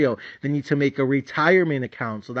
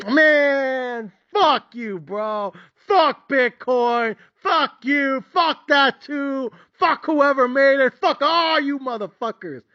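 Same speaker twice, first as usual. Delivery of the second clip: mock tantrum at the best friend; furious yelling; 9 semitones higher, much slower than usual. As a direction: lose it completely. Explodes at friend and Bitcoin.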